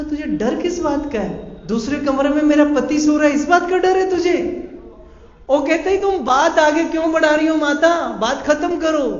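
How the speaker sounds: high, loud, urgent pleading; heated argument. Acted. Heated argument, woman and Sadna. (ऊँची, तेज़ आवाज़ में ज़ोरदार बहस)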